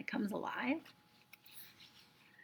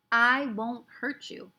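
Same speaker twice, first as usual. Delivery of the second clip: gentle reassurance; 3 semitones higher, 5 dB louder, much slower than usual. Acted. Omri soothing the tiny Indian.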